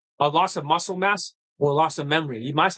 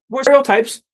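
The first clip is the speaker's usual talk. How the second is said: sudden loud burst; 6 semitones higher, 9 dB louder than usual. Real, not acted. Dating coach ranting at Indian viewers, sudden loud burst. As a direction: blurt it out much louder. Heated rant about stereotypes.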